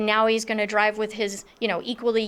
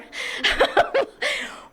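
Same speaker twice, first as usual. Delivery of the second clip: a sudden high burst of laughter; real high giggle. Real, not acted. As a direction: laugh at yourself, high and bright. Laughs at her own story.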